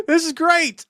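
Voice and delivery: high, loud burst of delight; delighted excitement. Retold or imitated. Viewer delighted by a character's success, delighted excitement. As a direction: exclaim it, thrilled and laughing. Acts own delighted reaction.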